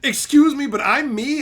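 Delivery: high, loud indignant imitation; mock entitlement. Timed-out viewer believing he's the center, mock indignation. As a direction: play the affronted main character.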